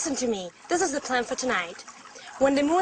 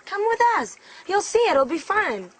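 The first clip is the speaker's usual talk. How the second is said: high, louder urging; eager coaxing; 6 semitones higher, 5 dB louder than usual. Acted. Friend urges Sarah to join the ghost hunt, coaxing. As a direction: coax them along, lifting the voice.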